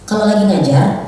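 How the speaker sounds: usual speaking voice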